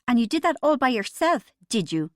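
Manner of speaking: high, loud sarcastic jab; a sudden shrill dig at her husband; shrill and loud, voice jumping up. A narrator's reading. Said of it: "her voice unexpectedly shrill".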